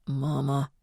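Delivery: low warning. A narrator's reading. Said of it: "he warned in a low voice".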